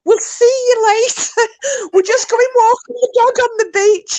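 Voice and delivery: high, loud, laughing through the words; laughing call. Retold or imitated. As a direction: call out while cracking up. Laughs while re-enacting the call.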